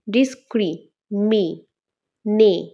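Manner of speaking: ডিসক্রিমিনে very slowly, ডিস-ক্রি, মি, নে; long pauses inside ডিসক্রিমিনে; split into syllables. Shown as a demonstration. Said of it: "কিভাবে পাই আমি তোমাদের বলছি". (ডিসক্রিমিনে খুব ধীরে ভাগে ভাগে)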